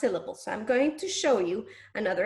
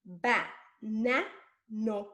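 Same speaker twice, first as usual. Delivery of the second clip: BA... NA... NO, one slow beat each; separated syllables; much slower than usual. Shown as a demonstration. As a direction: pause after each of BA, NA, NO. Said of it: "if I change the na for no".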